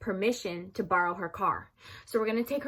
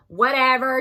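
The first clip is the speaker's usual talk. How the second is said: dismissive; 4 semitones higher, 12 dB louder than usual. Retold or imitated. Mom giving in dismissively.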